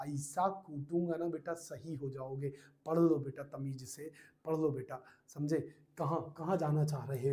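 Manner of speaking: low, hushed teacherly warning; soft, low mock scolding; quiet mock threat. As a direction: threaten softly, keeping the voice right down. Mock threat to scold chatting student.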